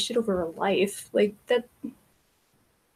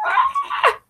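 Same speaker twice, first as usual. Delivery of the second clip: a sudden very high shriek. Real, not acted. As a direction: yelp as if caught off guard. Reacting to a clip.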